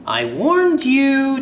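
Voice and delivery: high, slow, eerie told-you-so; ghost girl. Acted. Ghost Jane to the stone kid, high and slow. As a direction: draw it out like a spooky ghost. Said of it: "Jane says quietly".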